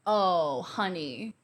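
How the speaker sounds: normal talking voice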